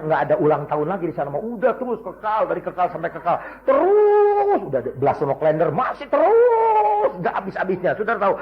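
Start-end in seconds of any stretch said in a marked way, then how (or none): 3.6-4.6 a ringing, endless "Terus"; long high drawn-out cry. Acted. Forever goes on and on. 5.7-7.1 a ringing, endless "Terus"; long high drawn-out cry. Acted. Forever goes on and on.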